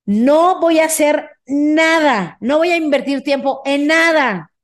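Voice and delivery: slow and deliberate, each word weighed; slow firm dictation; emphatic dictation. Shown as a demonstration. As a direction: spell it out slowly so they write it down. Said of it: "Apúntalo".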